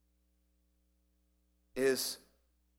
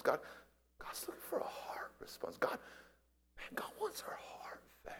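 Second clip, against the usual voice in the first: high, hushed whisper; breathy urgent preacher whisper; whispered intensity; 7 semitones higher, 10 dB quieter than usual. Real, not acted. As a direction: lean in and whisper the point.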